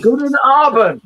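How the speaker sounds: slow, loud put-on German; hammy tourist German greeting. Greeting German viewer Jane.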